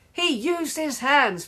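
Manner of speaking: high-pitched outraged objection; protesting. A narrator's reading. Margaret reporting a handball, high protest. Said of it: "protested Margaret".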